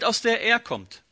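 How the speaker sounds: usual speaking voice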